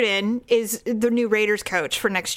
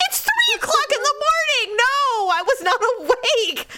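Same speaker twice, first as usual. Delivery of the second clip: high, exasperated mimicry; mock him protesting the hour; mock annoyed husband; 13 semitones higher than usual. Imitating Daryl scolding her at three in the morning, high and exasperated. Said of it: "He's like".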